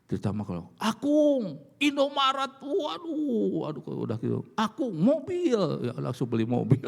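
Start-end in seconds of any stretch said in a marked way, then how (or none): 0.8-5.6 put-on higher childish pleading voice; begging grandchild. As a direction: whine like a grandkid pulling grandpa's sleeve.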